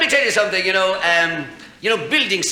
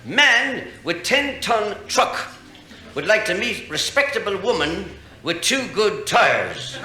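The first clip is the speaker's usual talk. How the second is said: slow, drawn-out reading of the ad; mock ad reading; 6 semitones higher, slower than usual. Shown as a demonstration. Comedian reading a lonely-hearts ad aloud, slow and drawn out. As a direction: savour each word of the ad, slow. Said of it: "I'm going to read to you now".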